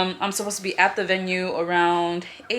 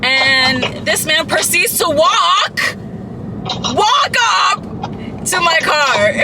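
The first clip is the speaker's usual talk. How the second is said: indignant building; 7 semitones higher, slower than usual. Real, not acted. Telling viewers the man followed her, rising indignant. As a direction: push the outrage up.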